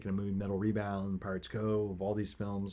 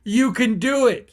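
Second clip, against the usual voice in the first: high, loud, drawn-out rallying cry; impassioned encouragement; 13 semitones higher, 11 dB louder, slower than usual. Real, not acted. Podcaster urging listeners into the water, impassioned. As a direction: urge them on at full intensity.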